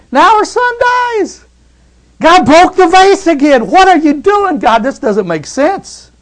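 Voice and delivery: high, loud, slow anguished cry; anguished widow. Acted. Grieving widow crying out to God, high and anguished. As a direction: wail it like a grieving mother.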